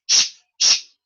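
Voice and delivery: hushing sh with no voice; unvoiced sh hush. Shown as a demonstration. Teacher demonstrating a voiceless sh. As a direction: shush it, air only. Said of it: "S H".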